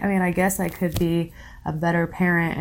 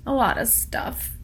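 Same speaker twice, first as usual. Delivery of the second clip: halting, higher voice close to crying; tearful, gathering herself; 4 semitones higher than usual. Real, not acted. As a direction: pull yourself together through tears. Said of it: "Let me gather myself".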